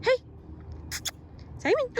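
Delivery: calling out. Real, not acted. Calling the dog.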